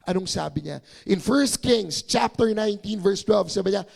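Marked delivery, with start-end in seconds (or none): none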